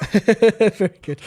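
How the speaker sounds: laughs through a slow, loud very good; amused approval, drawn out; laughing praise. Real, not acted. Teasing a co-host's mangled name, laughing.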